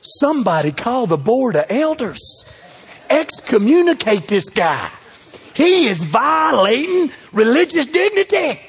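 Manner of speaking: slow, hammed-up scandalized churchgoer; mock outrage. Preacher mocks church folks scandalized by Jesus, hammed-up outrage. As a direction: play the horrified elders, rising.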